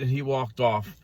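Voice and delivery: ordinary delivery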